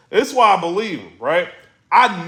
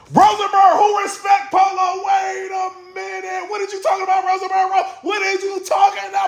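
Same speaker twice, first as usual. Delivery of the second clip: incredulous shout. Real, not acted. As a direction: shout it out like you can't believe what he said. Ranting at Rosenberg in disbelief.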